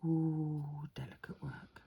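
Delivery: hushed whisper while concentrating. Real, not acted. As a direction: breathe it out softly. Concentrating on delicate nail art.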